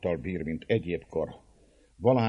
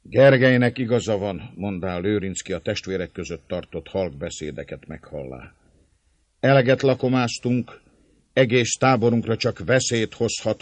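firm warning; 4 semitones higher, 7 dB louder than usual. A narrator's reading. Lőrinc warns the feast.

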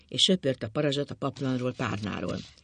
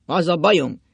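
loud, insistent opening; forceful start; 3 semitones higher, 9 dB louder than usual. A narrator's reading. Drunk husband starting his confession, loud and insistent. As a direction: announce it forcefully, then hold back.

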